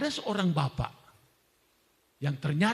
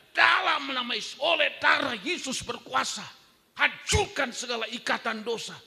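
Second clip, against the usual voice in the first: commanding prayer; 8 semitones higher than usual. Retold or imitated. Pastor praying over the man, high commanding proclamation. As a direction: cast it out in Jesus' name, full force.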